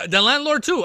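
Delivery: suddenly thrilled; high loud exclamation; excited realisation. Real, not acted.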